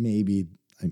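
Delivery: ordinary delivery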